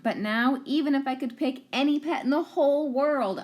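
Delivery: ordinary delivery, nothing marked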